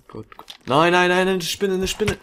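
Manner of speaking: high panicked pleading; panic, fast and high. Real, not acted. Panic at a spider.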